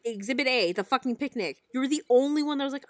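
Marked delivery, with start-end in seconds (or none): none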